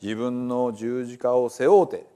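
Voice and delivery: Gospel told in lively Hiroshima dialect; dialect story reading. Acted. Reading the Bible to listeners as a dialect storyteller. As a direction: tell it like a hometown storyteller.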